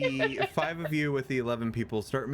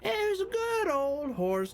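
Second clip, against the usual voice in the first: put-on very high, very slow rambling drunk voice; a drunk harpist reminiscing; old drunk; much slower than usual. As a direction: drawl it out like a sozzled old bard. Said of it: "And he's like".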